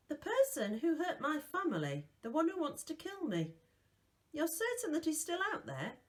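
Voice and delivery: put-on a young boy asking urgently; lightly voiced boy. A narrator's reading. Bod voiced higher than narration.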